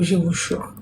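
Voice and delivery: normal talking voice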